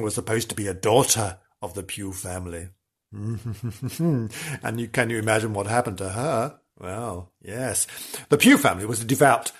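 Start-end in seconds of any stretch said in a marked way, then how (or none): 0.0-7.9 hushed storytelling. Pretend.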